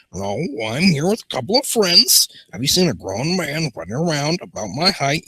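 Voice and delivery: high, swooping put-on voice; exaggerated sing-song character reply; character's answering voice. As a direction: lilt up and down in a silly high voice. Voices Julian answering the question.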